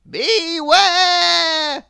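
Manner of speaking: high, loud warning call; ominous wizard warning. A narrator's reading. Wizard leaving Mister Mischief, ominous warning. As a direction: warn him darkly as you wave the wand. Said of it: "he said".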